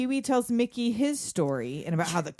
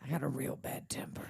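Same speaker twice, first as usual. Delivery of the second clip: low, hushed mock menace; menacing whisper; 7 semitones lower, 13 dB quieter than usual. Convict confiding in his passenger, menacing whisper. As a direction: whisper it like a dark confession.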